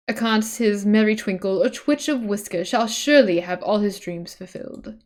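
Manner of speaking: ordinary talk, nothing marked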